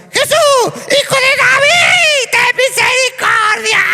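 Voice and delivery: a desperate crow-like shriek for mercy; crow-like screech. Shown as a demonstration. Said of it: "era más parecido a esto".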